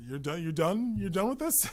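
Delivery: eager scavenger. Pretend. Hungry shadow asks for your leftovers, eagerly. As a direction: pounce on the leftovers with a high playful voice.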